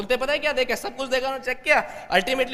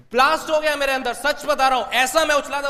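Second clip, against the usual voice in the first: ordinary talk, nothing marked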